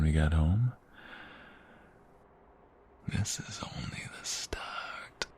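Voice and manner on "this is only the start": slow, soft, suggestive whisper; teasing whisper. Acted. Boyfriend hinting at more teasing at home, in a slow whisper. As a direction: let the promise hang, hushed and slow.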